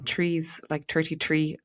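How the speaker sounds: "trees" and "thirty-three" with hard T in place of TH; Irish T for TH. Shown as a demonstration. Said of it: "I don't always say my T Hs correctly".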